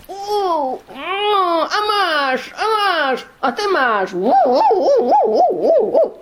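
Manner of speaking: put-on high, loud boyish fight cries; squealing little brawlers; fighting boys. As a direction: yelp and jab like scrapping kids. Boys fighting, voiced by narrator.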